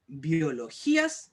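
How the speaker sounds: BIOLOGÍAS stretched out slowly, syllable by syllable; slowly sounded out. Shown as a demonstration. Teacher asking pupils about the accent mark, biologías slowly sounded out. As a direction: draw out "biologías" for the class. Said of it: "Si nosotros dijéramos aquí".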